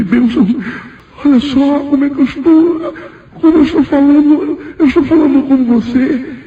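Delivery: re-enacted awestruck exclamation, pitched very high; high, marvelling cry of praise; glorifying God in amazement. Newcomer marvels to God at his own new language, high-pitched awe. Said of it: "comecei a glorificar a Deus".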